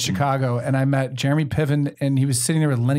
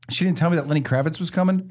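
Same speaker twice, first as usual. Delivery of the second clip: ordinary talk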